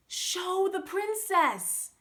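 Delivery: loud, high slow call from the crowd; shouting. A narrator's reading. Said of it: "someone shouted at Simpleton".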